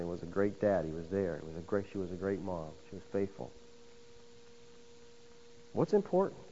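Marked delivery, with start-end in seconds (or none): 0.0-3.5 much softer, reverent reading of the tombstone; soft tribute; hushed epitaph. Acted. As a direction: let it fall quiet, like reading a grave. Tombstone words from family members.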